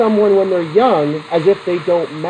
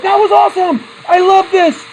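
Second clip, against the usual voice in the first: much higher and louder, thrilled whoop; gleeful excitement; 11 semitones higher, 4 dB louder than usual. Retold or imitated. Reporter cheering after the shotgun blast, whooping with glee. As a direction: crow with delight like a giddy reporter. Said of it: "Morton Downey Junior is like".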